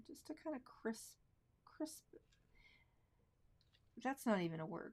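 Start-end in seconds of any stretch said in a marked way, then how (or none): 0.1-2.0 whispered under her breath while working; concentrated whisper. Real, not acted. Painter whispering to herself over a fine line. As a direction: murmur it to yourself as the brush moves.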